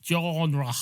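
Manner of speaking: "genre" drawn out with a French throaty r; French pronunciation. Shown as a demonstration. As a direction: attempt "genre" as if it were French. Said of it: "Am I supposed to pronounce it French?".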